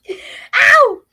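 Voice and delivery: loud high yelp; sharp yelp of pain. Real, not acted. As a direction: cry out in sudden pain. Sudden pain outburst.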